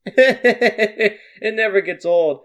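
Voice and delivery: laughing. Acted. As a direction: laugh in character at the neighbours' quarrel. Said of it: "falls over in his chair laughing".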